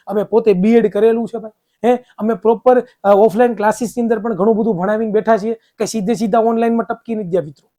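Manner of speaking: usual speaking voice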